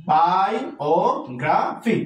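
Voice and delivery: বায়োগ্রাফি split slowly into separate syllables; teacher counting the syllables in বায়োগ্রাফি for students. Shown as a demonstration. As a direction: spell out বায়োগ্রাফি very slowly, one syllable at a time. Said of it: "দেখো আবার".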